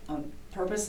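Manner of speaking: unvoiced, softly whispered; hushed ghost hunter asking about a death; whispered question. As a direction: drop to a whisper on the touchy word.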